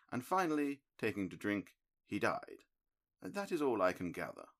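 measured, mildly disappointed sleuth; a hint of a cool analytical detective; Holmes, lightly voiced. A narrator's reading. Holmes listing deductions.

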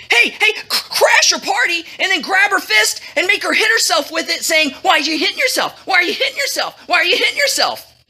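high, hurried, carried-away voice; overexcited yell. A narrator's reading. Overly excited guy pitches his plan to the king, yelling. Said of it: "An overly excited guy, getting caught up".

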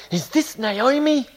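high, drawn-out excited question. A narrator's reading. Townswomen greeting Naomi's return, excited. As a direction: read it as amazed townsfolk. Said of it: "all the city was excited".

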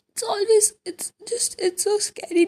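choked up, words catching on a sob; crying. Real, not acted. Teen upset over a film, voice cracking while crying. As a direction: voice breaking into tears. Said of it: "I have no idea why I'm crying this much".

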